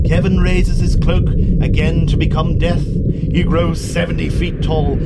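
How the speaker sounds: big, loud dramatic storytelling; epic narration. A narrator's reading. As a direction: boom it out like a trailer. Epic narration of Death rising.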